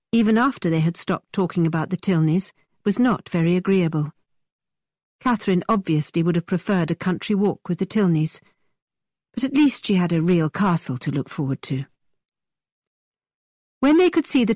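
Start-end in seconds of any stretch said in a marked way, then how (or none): none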